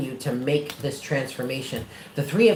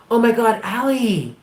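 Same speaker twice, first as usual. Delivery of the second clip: high, loud oh my God; light up at a friend arriving; greeting Allie with a loud, high burst; excited greeting; 6 semitones higher, 4 dB louder than usual. Real, not acted.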